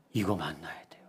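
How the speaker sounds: a whispered hint. Real, not acted. The pastor whispers a hint to the congregation. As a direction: whisper it like a secret clue.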